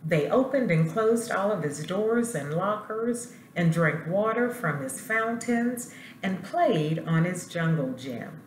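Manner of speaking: ordinary delivery, nothing marked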